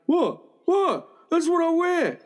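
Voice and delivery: put-on high-pitched, protesting young man; imitating defensive reply. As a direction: play the indignant neighbour's son. Son defends his footwear when scolded.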